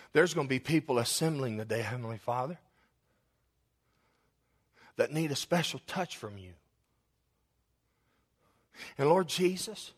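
spoken prayer that swells to a pleading climax, then drops to a hush; preacher praying over his church; fervent prayer. Acted. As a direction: pray aloud with rising fervour, then soften. Pastor prays aloud before congregation.

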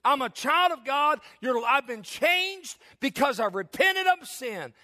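high, ringing pulpit declaration; a preacher calling out his commitment; full-force preaching. Acted. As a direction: proclaim it to the whole church.

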